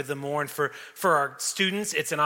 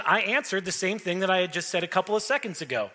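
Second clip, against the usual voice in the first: ordinary talk, nothing marked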